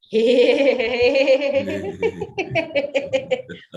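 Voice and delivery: bright laugh while pouring an imaginary drink; demo laugh. Shown as a demonstration. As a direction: laugh out freely as you pour. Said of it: "you're gonna go".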